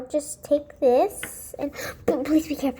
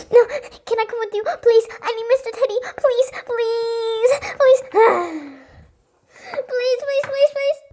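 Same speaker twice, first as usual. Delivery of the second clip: high, loud, sobbing begging; hammed-up tearful pleading; crying plea; 7 semitones higher, 5 dB louder than usual. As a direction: wail for the lost teddy bear.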